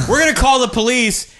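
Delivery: high-pitched mock yell; acted yell. Victims yelling at the car chasing them, acted yell. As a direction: call it out high, like a threat. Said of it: "and they yell".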